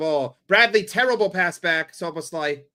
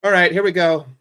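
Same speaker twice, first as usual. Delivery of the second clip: hyped announcement; 8 dB louder than usual. Real, not acted. Hyping the corner.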